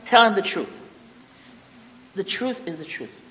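ordinary talk

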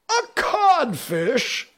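high, slow, stunned echo; blank echo. Acted. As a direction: repeat it in disbelief. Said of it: "Hook echoed blankly".

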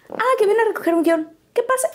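lightly voiced receptionist. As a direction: play the desk girl passing the message. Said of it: "me dice".

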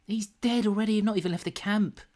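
high-pitched comic dismay; mock exasperation. Acted exasperation in comic supply run.

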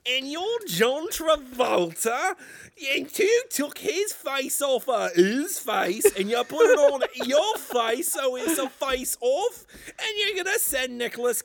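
incredulous British movie parody. Acted. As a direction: question it all in a comic British accent. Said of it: "Can we do my British voice again?".

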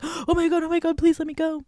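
high-pitched panicked pleading, mock terror; acting scared. Kidnapped friend begging on the rooftop, acting scared. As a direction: imitate a terrified captive begging. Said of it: "was, like, also acting scared".

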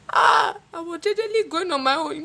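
high whiny fake sob; mock crying to father. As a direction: whine it like a wronged child. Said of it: "when you see your father, you now start crying".

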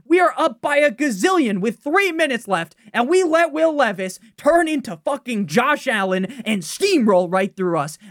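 loud, high furious rant; angry rant. Real, not acted. Fan raging about the blown lead, loud and angry. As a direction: vent your fury at the team. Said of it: "I'm sorry for yelling".

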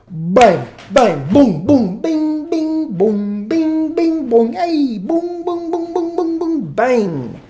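loud high bang-boom punch noises; cartoon fight sound effects; imitated punches. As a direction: act out the fistfight with sounds. Acting out the street fight.

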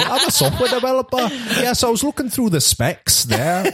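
high, amused Geordie imitation; hammed-up Newcastle accent. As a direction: parody a Geordie office worker, pitched high. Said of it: "that's kinda Geordie".

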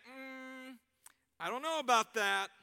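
doubtful brush-off. Acted. Acting Lily's doubtful reply.

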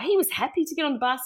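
ordinary talk, nothing marked

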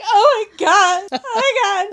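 high, loud, squealing Oh my God; shocked squeal. Retold or imitated. Telling a friend her stunned reaction, high excited squeal.

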